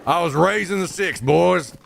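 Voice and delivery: put-on Southern good ol' boy accent, laid on thick. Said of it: "the voice for fun".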